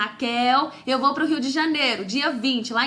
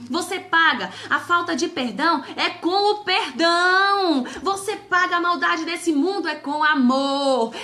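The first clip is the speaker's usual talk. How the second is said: loud, slow, emphatic sermon delivery; full-force preaching; 2 semitones higher, 4 dB louder than usual. Acted. Preacher to live viewers on forgiveness, loud and fervent. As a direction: build it up and hammer each line home.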